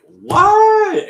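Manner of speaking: high, loud disbelieving what; shocked what. Retold or imitated. Retelling her own reaction to the show, loud shocked yelp. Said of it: "And I was like".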